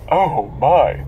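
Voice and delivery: drawn-out knowing "oh my"; a hint of George Takei. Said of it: "quote George Takei".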